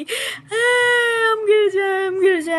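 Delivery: put-on child crying on a tree, calling that she will fall; imitated crying. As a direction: wail it like a scared little girl stuck up a tree. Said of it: "खूब पूरा रोने लगे".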